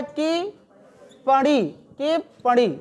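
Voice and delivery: टिप्पणी stretched out very slowly; slow dictation. Shown as a demonstration. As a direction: spell टिप्पणी aloud syllable by syllable. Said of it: "क्या होता है?". (धीरे-धीरे उच्चारण)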